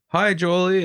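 put-on a mom calling hello to her kid; high-pitched retold greeting; imitated mom greeting. Mom greeting child on camera.

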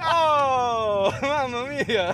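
a playful fake Italian exclamation; mock Italian accent. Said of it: "do you hear that authentic Italian accent".

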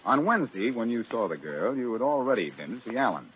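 ordinary delivery

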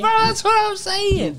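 high, laughing, fired-up agreement; excited agreement. Real, not acted. Laughing hard as his friend mocks the tiny crowd, high and excited. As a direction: jump in to agree, still laughing.

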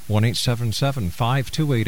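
ordinary delivery

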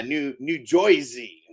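slow New Jersey accent, "Jersey" said like "Joisey"; Jersey pronunciation. Shown as a demonstration. Showing his friend how New Jersey locals say it, in a slow Jersey accent. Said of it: "is how the Jersey people say it".

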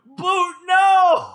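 high, loud mock wail; goofy upset. Upset viewer crying to Boot, goofy wailing shout. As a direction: cry out in goofy anguish. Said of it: "upset in, like, a very goofy way".

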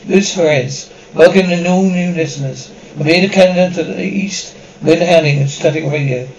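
usual speaking voice

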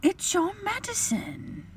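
slow, soft, very high voice; reproachful wife. A narrator's reading. Wife reproaches husband, soft dismay. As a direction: breathe it in dismay.

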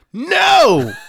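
sudden loud high shout of protest; outraged shout. Real, not acted. Fan shouting no at the sports channel. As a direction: yell out a disbelieving no. Outraged at ESPN ignoring Luka.

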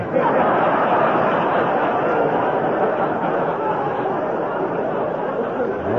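long breathy chuckle of delight; hearty laugh. Acted. Andy laughing over the reverend's clever advice, delighted chuckle. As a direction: laugh it off, tickled pink.

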